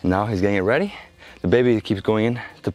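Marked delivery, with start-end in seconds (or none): none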